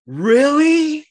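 a high, loud, disbelieving "really?"; the kid can't believe it, pitch jumps up; surprised kid. Retold or imitated. Kid reacts in class.

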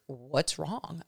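soft, quiet asking what's wrong; a concerned onlooker asking quietly; hushed concerned question. Retold or imitated. Onlooker asks her what's wrong, hushed and soft. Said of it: "you'd be like".

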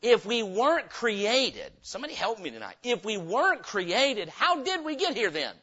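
ordinary delivery

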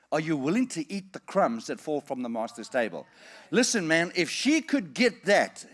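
ordinary delivery

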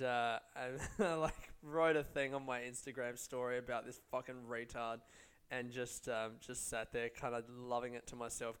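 normal talking voice

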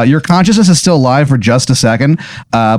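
usual speaking voice

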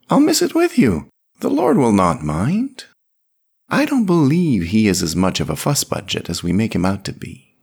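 put-on high, fond voice of an old priest; a kindly priest easing his friend, pitched high and loud; gentle old priest Sébastien. A narrator's reading. Sébastien's voice in the story.

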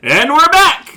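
big bright show opener; loud cheerful welcome back; excited. Real, not acted. Podcast intro after countdown.

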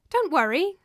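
a hint of a bright girlish reassurance; light bright voice. A narrator's reading. Young woman calming startled guest: light bright voice. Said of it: "said a light, bright voice".

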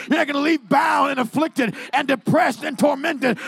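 fiery revival preacher crying freedom over the room; preaching at full force. Acted. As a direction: preach it with everything you have, building to the last line. Preaching climax to the congregation.

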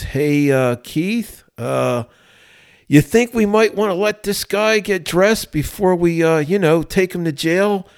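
high-pitched, hesitant impression of a sergeant; Gary Russ. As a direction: imitate a sergeant's halting, high-voiced polite suggestion. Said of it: "this is how he talked".

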